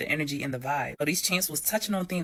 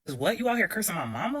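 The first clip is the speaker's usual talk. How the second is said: ordinary delivery, nothing marked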